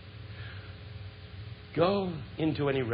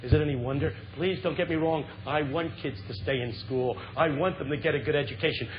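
usual speaking voice

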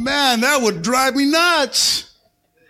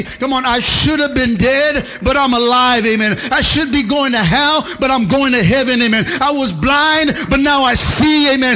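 loud, high, shouted sermon climax; full-force preaching; 4 semitones higher, 6 dB louder than usual. Acted. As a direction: preach it out loud to the whole church.

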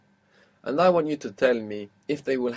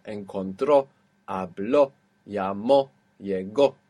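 "Encontró, habló, llamó, llegó" each stressed on the final ó; final-syllable stress; 3 semitones lower, 4 dB louder, slower than usual. Shown as a demonstration. Teacher lists past-tense verbs, "Encontró, habló, llamó, llegó" each end-stressed. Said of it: "are gonna have that accent".

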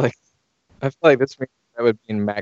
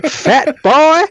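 loud, high-pitched Texas jeer; hammed-up Texas accent, taunting; mock Texan taunt; 6 dB louder than usual. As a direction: hurl it like a huffy cartoon Texan.

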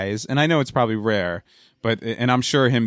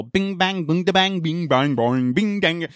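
high sing-song nonsense syllables; mock [name] scat; 7 semitones higher, slower than usual. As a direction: lightly mimic a singer's bing bang scat. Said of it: "[name] definitely falls into that with the whole".

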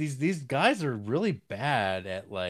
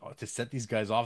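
usual speaking voice

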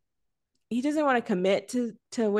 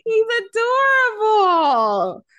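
gushing; 12 semitones higher, 7 dB louder, much slower than usual. Real, not acted. Gushing over cute actor.

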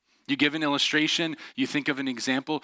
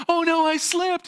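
high, loud fake cry of alarm; mock panic; 5 dB louder than usual. Boy teasing his sisters in the tree, mock panic. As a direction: pretend you're falling, pitched way up. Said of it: "freaking my sisters out".

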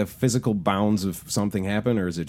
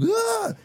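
very high-pitched cartoon yelp; imitated yelp. Retelling friend with snow in his shoes, high mock yelp. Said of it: "he was like".

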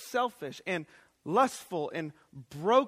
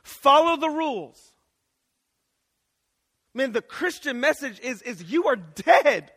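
loud, raised preacher's voice; full-force preaching; 4 semitones higher, 11 dB louder than usual. Real, not acted. Preacher telling the church they have failed God's law, full-force preaching. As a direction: drive each phrase home with heavy loud stresses.

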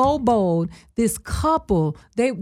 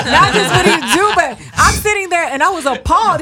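laughing through the words, higher and louder; 6 semitones higher, 4 dB louder than usual. Real, not acted. Telling friends about the catcaller, laughing. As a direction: laugh out the outrage.

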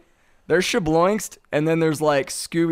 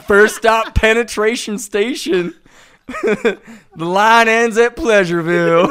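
louder, hammed-up train announcer call; mock conductor; 4 semitones higher, 7 dB louder than usual. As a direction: call the stops like a joking conductor.

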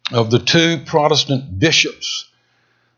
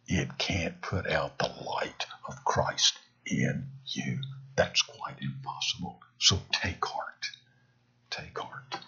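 soft unvoiced whisper, fading to nothing; whispered, lower and softer encouragement; whispered comfort; 11 semitones lower, 15 dB quieter than usual. Real, not acted. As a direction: breathe the reassurance to them. Closing comfort to the congregation.

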